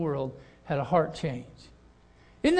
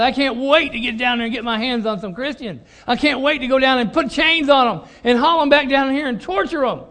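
gloating Saul; 6 semitones higher, 6 dB louder than usual. Retold or imitated. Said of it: "almost like rubbing his hands together".

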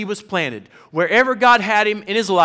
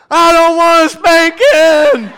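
put-on much higher, louder, slow childish wail; whiny little boy; 12 semitones higher, 12 dB louder, slower than usual. Son wailing to dad against a spanking. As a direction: whine it like a kid begging. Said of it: "And Jude goes".

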